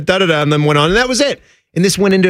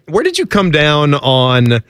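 ordinary talk, nothing marked

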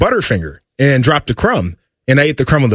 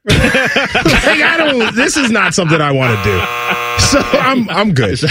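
laughing; 8 semitones higher, slower than usual. Real, not acted. Laughing at own joke.

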